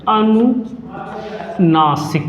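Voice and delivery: अनुनासिक drawn out very slowly, syllable by syllable; slow dictation. Shown as a demonstration. Teacher to class, dictating the term very slowly. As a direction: stretch अनुनासिक so students can write it. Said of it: "अनुनासिक देख लीजिए".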